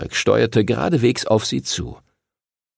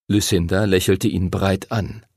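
plain reading voice

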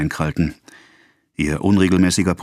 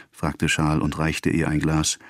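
usual speaking voice

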